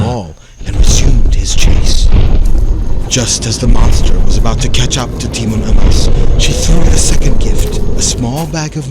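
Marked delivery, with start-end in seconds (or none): none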